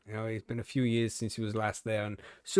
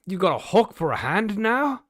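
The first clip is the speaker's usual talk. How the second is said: mock surprise; 5 semitones higher, 5 dB louder than usual. Barman shocked in joke.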